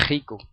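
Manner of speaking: "Rico" starting with a rasped kh; throat-scraped R in "Rico"; Puerto Rican R. Shown as a demonstration. Teacher showing the word-initial habit, velar Puerto Rican R. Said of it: "pronunciamos la R de esa manera".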